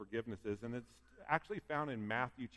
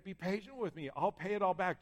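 higher-pitched, lightly acted plea; mild storyteller's begging; 6 semitones higher than usual. A narrator's reading. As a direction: plead in a raised pitch. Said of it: "fell to his knees and begged him".

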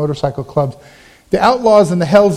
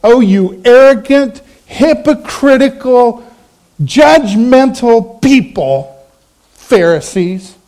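slow, mock-scornful name-calling; scornful accusation; 5 semitones higher, slower than usual. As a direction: spell out each insult slowly, pitched up. Outlaws insulting the Pharisees back.

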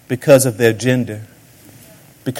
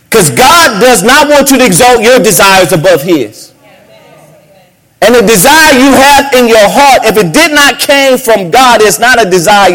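rising sermon cry, higher and louder; preaching at full force; 8 semitones higher, 6 dB louder than usual. Acted. Pastor preaching to the congregation, full-force preaching. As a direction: drive it home from the pulpit, full voice.

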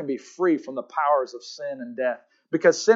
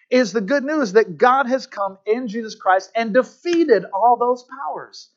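slow, raised preacher's cadence; forceful preaching; 6 semitones higher, 4 dB louder than usual. Acted. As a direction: drive each question home, high and drawn out.

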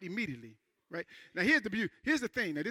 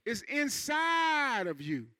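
slow emphasis; 4 semitones higher, much slower than usual. Real, not acted. Preacher stressing the answer.

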